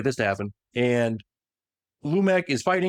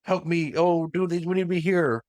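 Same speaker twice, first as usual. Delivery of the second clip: higher-pitched, put-on helpless call; fake plea for help; 5 semitones higher than usual. Enemy tricking soldiers over the Vox, mock pleading. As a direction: play a lightly acted decoy begging comrades over. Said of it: "he's tricking them with the Vox voice".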